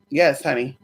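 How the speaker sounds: usual speaking voice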